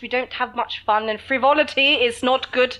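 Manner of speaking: slow hammed-up posh lecture on manners; mock Downton Abbey posh. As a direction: parody a stiff aristocrat. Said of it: "that Downton Abbey that's".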